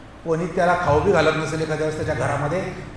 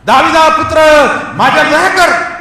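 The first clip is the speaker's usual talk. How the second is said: high, full-voiced shout for mercy; desperate loud plea, pitched high; louder crying out; 10 semitones higher, 10 dB louder than usual. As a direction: shout even harder to be heard. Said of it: "तो अधिक जोरात ओरडायला लागला".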